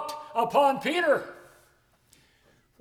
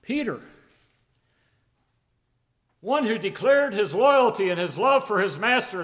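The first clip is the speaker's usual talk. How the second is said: normal talking voice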